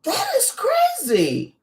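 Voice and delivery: high, drawn-out disbelief; real astonishment. Real, not acted. Listener hearing sweat turned to frogs, astonished. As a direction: let the pitch leap up in amazed reaction.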